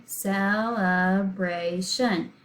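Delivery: "celebration" slowly broken into four beats; syllable by syllable. Shown as a demonstration. Teacher counting the four syllables for pupils, slow and broken up. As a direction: say "celebration" one syllable at a time. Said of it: "we said it slowly and broke it up into syllables".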